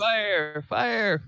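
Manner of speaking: high, loud, drawn cries for help; calling out. Acted. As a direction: call out as the wounded character. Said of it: "calling out".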